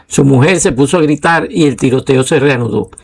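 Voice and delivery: ordinary delivery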